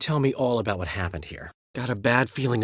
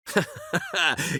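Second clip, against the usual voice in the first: high mocking laugh. Laugh after teasing.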